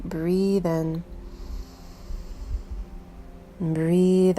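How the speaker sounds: gentle meditation voice with long, unhurried pauses; soothing meditation guide, letting each instruction settle; guided meditation. Real, not acted. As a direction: ease the listener inward, leaving space between phrases.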